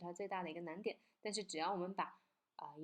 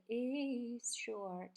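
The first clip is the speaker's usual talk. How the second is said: "is" stretched out before "short"; "is" set apart; 3 semitones higher than usual. Shown as a demonstration. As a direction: hold "is" long and slow. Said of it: "他自己单独给它加细了". (单独拉长“is”)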